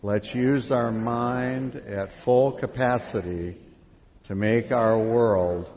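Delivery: slow, loud, measured recitation; reading out loud together. Real, not acted. Leader reads songbook words aloud with the congregation, slow loud recitation. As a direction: read it out in unison, drawn out and strong. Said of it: "Read it out loud".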